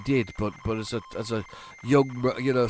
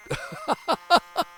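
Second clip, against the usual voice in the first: high laugh. Real, not acted. Laughing at loading chant.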